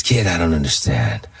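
lightly voiced older star, pitched low; low-pitched retold voice of a screen veteran; Burt Reynolds. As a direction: drop low, imitating a movie star talking to a kid. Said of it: "So he goes".

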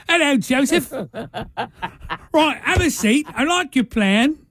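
high, hammed-up British accent through laughter; mock British accent. Co-host welcomes Joseph to the meeting, in a high mock British accent. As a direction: play along with a silly high British voice. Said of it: "If we give them British accents".

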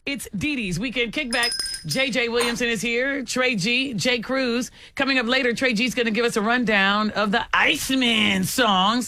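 upbeat radio promo voice; radio host read. Acted. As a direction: pitch it like a DJ teaser.

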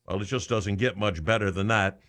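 normal talking voice

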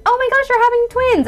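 mock excited squeal, very high; hammed-up delighted announcement; happy and positive. Shown as a demonstration. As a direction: gush the news as overjoyed congratulations. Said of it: "like, a happy, positive".

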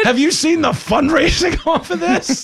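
high, slow, cracking up mid-line; laughing mock Republican. Host mocking party leaders, laughing and high. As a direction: giggle through the punchline. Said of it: "They were like".

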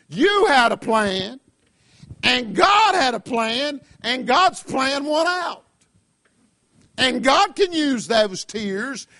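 preaching. Acted. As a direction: drive each phrase home. Preaching at full force.

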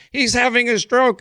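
high mocking voice; mocking critics. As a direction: mimic the critics, pitched high. Mocking critics' online comments.

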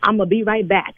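ordinary delivery

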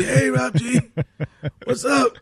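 high-pitched mimicry of a colleague, amused; Rob G. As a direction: echo his high voice through laughter. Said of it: "then I sound like Rob G".

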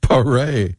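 "Paré" as "puh-RAY"; French pronunciation. Shown as a demonstration. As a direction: stress the final "ray" in "Paré". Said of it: "oh, he's French".

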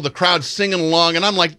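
ordinary talk

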